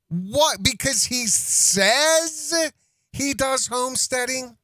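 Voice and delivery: high, slow, disbelieving; incredulous mocking. Real, not acted. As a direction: stretch it out in disbelief.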